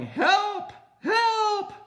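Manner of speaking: high frantic cries of help; papa dangling from the kite yells for help, high and frantic; panicked yell for help. A narrator's reading. Papa hanging from a kite, frantic high yelling. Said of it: "He started yelling".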